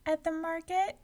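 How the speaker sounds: put-on high small child's voice; little girl. A narrator's reading. Girl answering the giant, high and small.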